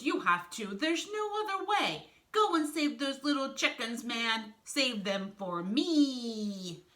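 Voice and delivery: loud, melodramatic noble farewell; self-sacrificing Piranha, impassioned. Acted. Piranha urges Wolf to save the chickens, heroic. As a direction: plead heroically, dragging out the last word.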